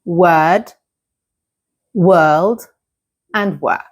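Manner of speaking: word, world, work set apart with pauses; careful contrast of word, world, work, rising until the last; contrasting similar words. Shown as a demonstration. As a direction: list word, world, work slowly and distinctly. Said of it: "you can understand the difference".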